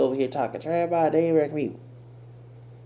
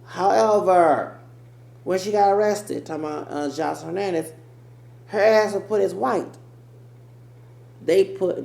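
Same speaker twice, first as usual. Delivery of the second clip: worked up; 8 semitones higher, 8 dB louder than usual. Real, not acted. Worked up telling the arrest.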